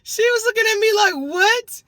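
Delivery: high, loud squeal through laughter; cracking up. Retold or imitated. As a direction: shriek the retold reaction while cracking up. Retells officer's reaction amid laughter.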